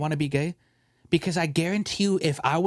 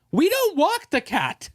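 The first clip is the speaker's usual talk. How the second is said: exasperated; 12 semitones higher, 3 dB louder, slower than usual. Real, not acted. Exasperated comeback.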